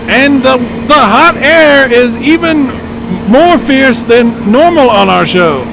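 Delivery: shouting over roaring wind, high and loud; yelling over hurricane winds. Pretend. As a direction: storm reporter yelling into the gale. Mock live storm reporter.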